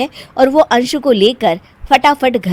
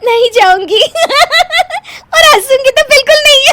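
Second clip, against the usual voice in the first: high, fast, loud giggly promise; shrieking laughing vow; 8 dB louder than usual. Acted. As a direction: promise not to laugh and crack up. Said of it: "तुम तो फिर से हंस दी".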